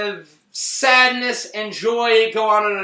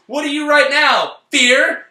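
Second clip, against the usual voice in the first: higher, louder mock mom question; mom asking; 6 semitones higher, 6 dB louder than usual. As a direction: quiz the child like a coaxing mother. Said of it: "And the mom goes".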